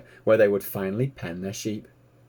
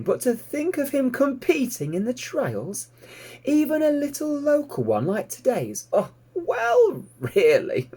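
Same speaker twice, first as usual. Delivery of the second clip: rising much higher in incredulity; amused disbelief. Acted. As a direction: climb higher and higher at how daft it is. Fly incredulous at the idea.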